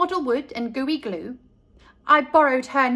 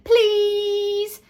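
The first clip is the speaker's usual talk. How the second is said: pleading; 7 semitones higher, 4 dB louder than usual. A narrator's reading. Child begging dad.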